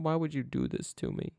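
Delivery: normal talking voice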